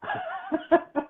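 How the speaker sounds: high-pitched burst of laughter; real high giggling burst. Real, not acted. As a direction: crack up in a high cackle. Reacts to flirty joke.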